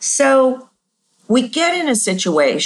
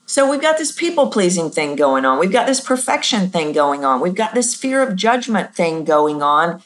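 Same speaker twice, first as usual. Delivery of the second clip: ordinary delivery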